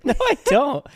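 high-pitched laughing protest; laughing denial. Real, not acted. Husband laughing as he denies his wife's claim. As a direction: laugh it off while denying it.

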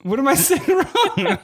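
high, drawn-out laughing protest. Real, not acted. Husband asking what he says wrong, laughing. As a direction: plead through laughter, slow and high. Baffled, cracking up at being corrected.

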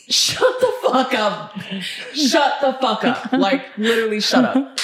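slow exasperated shut up. Real, not acted. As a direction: drag it out, fed up. Annoyed ranting at critics.

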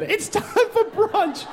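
very high sing-song "time for brunch"; posh mother calling. Retold or imitated. Building mother calls Wolfgang to brunch, very high. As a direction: call him to brunch airily. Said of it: "That's what the woman said".

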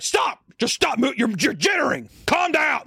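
high, strained mock shouting; retold yelling. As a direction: imitate an exasperated man yelling orders. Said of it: "Like, yelling at me".

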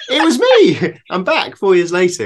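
spring the surprise, laughing; delighted reveal. Retold or imitated. Cyclist revealing himself to the grown-up schoolboy, gleeful. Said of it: "And I'd say".

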